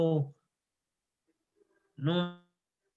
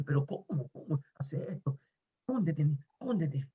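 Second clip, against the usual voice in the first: soft, half-whispered urging; hushed urgent quote; 5 dB quieter than usual. Retold or imitated. Neighbour urging a boy to hide from his father, hushed. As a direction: urge someone to hide under your breath. Voices the neighbour hiding him.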